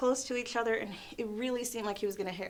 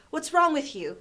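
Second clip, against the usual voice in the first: loud, higher retold challenge; raised-voice mock indignation; mimicked nastiness; 6 semitones higher, 5 dB louder than usual. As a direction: imitate a nasty customer demanding an answer. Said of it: "he got really nasty".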